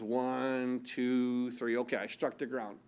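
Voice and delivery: low, soft, bored going through the motions; lackadaisical. Acted. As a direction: count it off with no effort at all. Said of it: "he's kind of lackadaisical".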